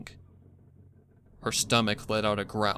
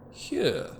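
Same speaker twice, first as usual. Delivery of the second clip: a higher, mock-sweet villain's offer; sinister villain voice; 10 semitones higher than usual. The cult offers the caged filly a treat, falsely sweet. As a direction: sound kind while meaning harm.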